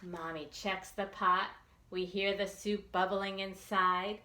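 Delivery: usual speaking voice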